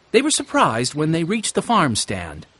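plain reading voice

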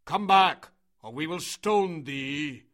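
loud, threatening shout; crowd shouting. A narrator's reading. Crowd threatening Meswa with stones, shouting. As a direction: yell the threat, slowing at the end. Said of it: "shouted the crowd".

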